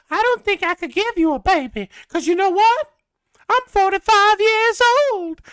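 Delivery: prim southern lady voice, way up high; southern belle. Retold or imitated. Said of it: "her southern accents".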